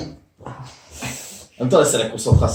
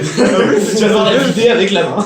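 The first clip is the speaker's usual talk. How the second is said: laughing; 6 semitones higher, 8 dB louder, faster than usual. Real, not acted. Laughing at friend's penalty.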